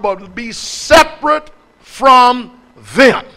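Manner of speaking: slow, stretched, every word weighted; added emphasis. Shown as a demonstration. Preacher stressing scripture to listeners, slow heavy emphasis. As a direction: lean hard on each word. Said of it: "Emphasis added".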